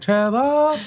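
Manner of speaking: imitated mom's high, musical holler; rhythmic mom calling. Son mimics mom calling him across the house, rhythmic high call. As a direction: sing out the name, holding the end. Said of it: "like, a rhythmic thing".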